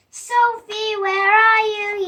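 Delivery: loud call into the dark wood; yelling. Acted. Boy lost in the woods yelling for his sister. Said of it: "he yelled".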